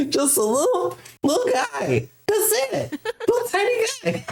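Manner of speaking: high squeaky giggling little guy; mock-cute voice cracking into laughter; laughing mockery. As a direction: laugh through it, pitch way up. Mocking Irvine, laughing.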